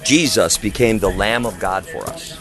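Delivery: normal talking voice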